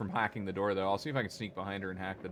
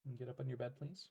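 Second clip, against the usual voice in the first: soft, quiet teasing to an animal; fond half-hearted telling-off; gentle pet scolding; 3 semitones higher, 7 dB quieter than usual. Real, not acted. Owner softly telling off the pet rubbing on him.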